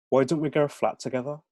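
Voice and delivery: put-on Brummie accent. [name] proposing a shared flat to Fraser, Brummie accent. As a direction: float the idea in a Birmingham accent. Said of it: "aren't familiar with the Brahmi accent".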